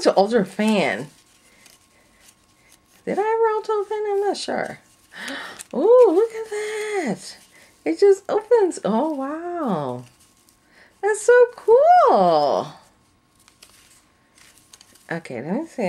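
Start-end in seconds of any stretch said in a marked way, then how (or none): 3.1-12.7 high, gushing voice; excited. Real, not acted. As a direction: coo over it in a much higher voice. Playing with the new fan.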